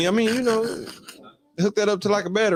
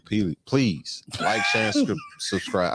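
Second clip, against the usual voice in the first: laughing through it; 6 semitones lower, slower than usual. Real, not acted. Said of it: "I can't say it".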